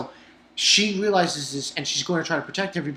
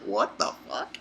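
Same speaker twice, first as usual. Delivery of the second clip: breathy whisper, low and soft; soft whispered what the fuck; whispered disbelief; 8 semitones lower, 7 dB quieter than usual. Retold or imitated. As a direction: hiss it under your breath in shock. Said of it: "It was like".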